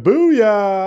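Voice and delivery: loud, high booyah; whooped booyah; triumphant cheer. Real, not acted. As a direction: cheer yourself on after landing the joke. Celebrates own joke.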